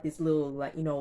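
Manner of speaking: normal talking voice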